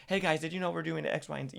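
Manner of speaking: put-on vlogger opener; imitated on-camera greeting. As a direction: chirp it to the viewers as a mock intro. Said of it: "when I turn to the camera".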